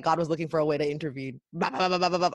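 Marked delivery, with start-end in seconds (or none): none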